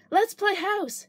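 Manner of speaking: put-on high eager child. A narrator's reading. Child Angela suggests a game.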